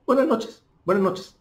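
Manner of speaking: Buenas noches rattled off fast and high; quick Spanish greeting. Shown as a demonstration. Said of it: "No espanhol dizia". (Buenas noches rápido e agudo, ritmo espanhol)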